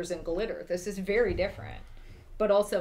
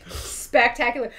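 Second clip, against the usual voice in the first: Spectacular said higher and louder; proud emphasis; 4 semitones higher, 8 dB louder than usual. Real, not acted. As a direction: land Spectacular with pride. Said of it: "and I'm spectacular".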